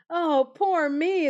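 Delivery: slow, high lament; wailing wagoner. A narrator's reading. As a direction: cry out at the loss. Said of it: "the wagoner cried".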